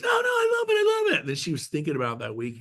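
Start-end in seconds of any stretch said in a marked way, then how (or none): 0.0-1.3 high, loud, strained cry of "I love it"; mock a forced over-the-top protest, nearly a shout; overeager insistence. Wendy insisting to her friend, high strained shout. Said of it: "And she goes".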